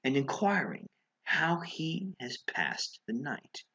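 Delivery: plain reading voice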